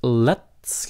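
LET'S briefly held, then released in a hissed S. Shown as a demonstration. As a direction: hold the end of LET'S, then hiss it. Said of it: "cái xong xì nó cái". ("let's" nhấn hơi lại rồi xì)

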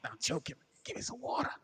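high, soft strained plea for water; choking plea. Retold or imitated. Choking man begs his friend for water, high and strained. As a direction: beg weakly like you can't breathe. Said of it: "And I'm like".

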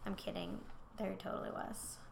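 ordinary talk

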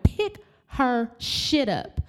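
high, slow and deliberate, every word hammered; slow furious order. Retold or imitated. Daughter ordering staff to pick up clothes, slow furious command. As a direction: spell it out to them with fury.

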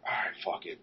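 breathy unvoiced whisper, just air; whispered to himself. Teen whispers to himself before approaching the celebrity. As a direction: hush it out like a private dare to yourself.

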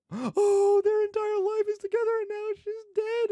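high, loud, tearful lament; mock sobbing. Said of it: "and be like".

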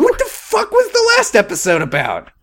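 high, squeaky indignant question; mock outrage. Podcaster mock-demanding what the episode was. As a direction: squeak it in disbelief.

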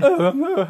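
high, hammed-up creature grunts; high mock-monster noises; mutated creature noises. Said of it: "and then they just became".

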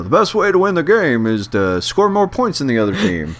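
sportscaster stating the obvious; booming football commentator voice; John Madden. Pretend. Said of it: "sounds like a very John Madden, Maddenism".

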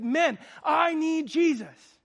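imitating a man overcome, begging for Jesus; retold broken plea. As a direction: draw it out like a man breaking down. Man weeping, realizes his need.

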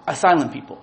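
usual speaking voice

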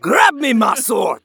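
drawn-out gruff demand; lightly voiced character demand; slow repeat of the demo line. Shown as a demonstration. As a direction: say it slowly in the character's voice. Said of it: "That's so good".

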